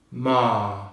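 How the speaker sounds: "ma" with an extended "ah"; long vowel, silent R. Shown as a demonstration. Teacher returning to the correct model, long "ma". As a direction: hold the vowel in "ma", no r. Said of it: "we extend the pitch on the vowel sound".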